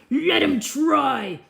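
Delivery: a firm, slowed order from the princess; commanding princess. Acted. Princess commanding the trousers be tried. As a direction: give the command slowly and firmly. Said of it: "can I reread that part?".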